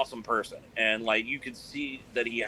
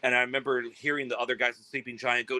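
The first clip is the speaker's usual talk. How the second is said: ordinary talk, nothing marked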